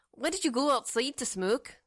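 light Scottish lilt on every word; a hint of a Scottish brogue; Scottish accent. Shown as a demonstration. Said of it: "that's like Scottish".

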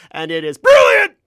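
huge hammed-up shout on brilliant; all-caps shout. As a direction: explode on the last word as if printed in capitals. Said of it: "brilliant was in all caps".

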